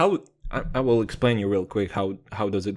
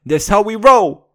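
higher, louder hype shout; gangster shout; 9 semitones higher, 11 dB louder than usual. Acted. Mock gangster rapper shouting a boast. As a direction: hype it like a crowd chant.

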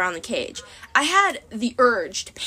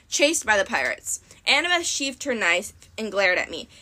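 ordinary delivery, nothing marked

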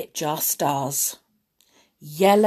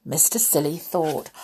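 usual speaking voice

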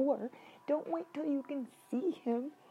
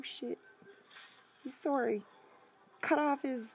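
ordinary talk, nothing marked

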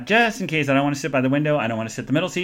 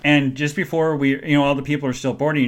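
ordinary delivery

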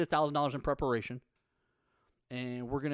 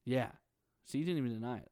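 normal talking voice

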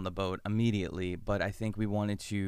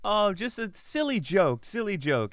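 high, slow, louder sing-song brush-off; mock dismissive; 11 semitones higher, 5 dB louder than usual. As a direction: laugh the whole thing off as a silly joke. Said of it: "or he's like".

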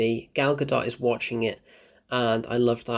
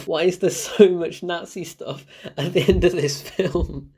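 laughing question; 4 semitones higher than usual. Real, not acted. Ranting about Nazi plot.

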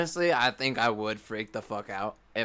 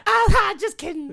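put-on high and slow, childlike; drawn-out kid voice, much higher; child voice; 7 dB louder, slower than usual. Friend imitating the voicemail in a child voice. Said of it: "sounds like a child".

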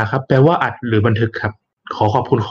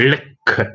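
"record" slowly as REC-ord, the noun; stress on first syllable; 2 semitones higher than usual. Shown as a demonstration. Presenter teaches the noun reading, first-syllable stress. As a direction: lean on "rek" at the start of "record". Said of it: "ถ้าเราเน้นคำหน้า อ่านว่า".

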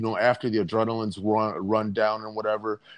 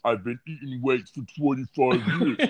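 meathead bodybuilder voice. Retold or imitated. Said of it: "And they're like".

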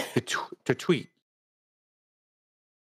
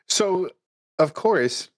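ordinary delivery

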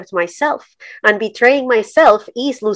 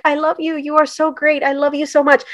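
mock gushing; 5 semitones higher than usual. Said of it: "people in the U S will look at me and be like".

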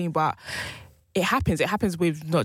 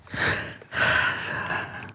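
long weary exhale; withering sigh. Real, not acted. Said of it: "Why are you sighing like that?".

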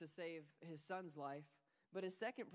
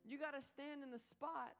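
impassioned father; 7 semitones higher than usual. Retold or imitated. Father explaining to his son where to stand, fervent. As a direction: urge him to understand. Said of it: "he says".

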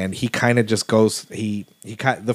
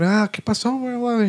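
much higher, playful mock greeting; homeboy's greeting; 13 semitones higher than usual. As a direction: toss it off high and chummy. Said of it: "my homeboy starts chopping".

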